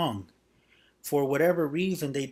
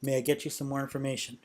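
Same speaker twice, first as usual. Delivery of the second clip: quick, easy offer of more information; quick demo question; faster than usual. Shown as a demonstration. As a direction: toss the offer out quickly and lightly. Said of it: "Immediately go into".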